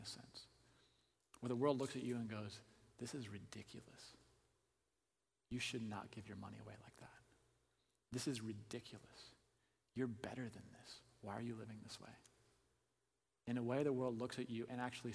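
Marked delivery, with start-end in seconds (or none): 3.0-12.1 hushed disapproval. Acted. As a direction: softly voice the disapproving onlooker. Voicing the disapproving world to a believer.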